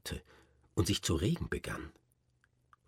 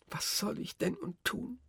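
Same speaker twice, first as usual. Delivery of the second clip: higher, more pleading with stress on soll; desperate tearful plea; 5 dB quieter than usual. Acted. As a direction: lean on soll in despair. Repeated lament, more desperate.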